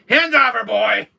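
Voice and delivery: loud, high warning to back off; possessive shout. Pretend. Warning Chris away from the Ada waifu, possessive shout. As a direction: shout him away from your figure. Jealously guarding the waifu figure.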